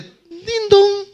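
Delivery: high, loud sung ding-dong of a doorbell; doorbell imitation. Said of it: "que suene el timbre". (imitación cantada de un timbre, aguda y fuerte)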